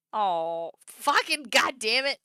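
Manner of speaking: loud, high, slow exasperated cry; frustrated groan. Real, not acted. Streamer overcome by the sad scene, loud exasperation. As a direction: groan it out, overwhelmed.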